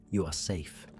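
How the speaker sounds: ordinary delivery, nothing marked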